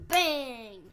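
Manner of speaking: loud bang. Pretend. Child celebrates pick.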